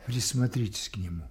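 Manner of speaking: usual speaking voice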